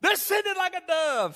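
high, loud and slow pulpit cry; slow desperate yell. Retold or imitated. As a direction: drag it out, pleading for the dove. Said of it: "he yells it out".

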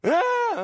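loud, very high mock wail; imitated baby wail. Preacher mimicking a baby put back down, loud mock wail. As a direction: burst out like a bawling infant. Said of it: "and they're crying".

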